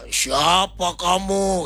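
very drawn-out, eerie demon question; possessed person's challenge. Retold or imitated. Possessed person challenges the visiting pastor, drawn-out demon voice. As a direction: stretch each word like a haunting spirit. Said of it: "dia, dia tanya".

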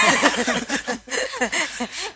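a bright burst of laughter, rising; hearty laugh. Real, not acted. Host laughs after joke. (tertawa lepas)

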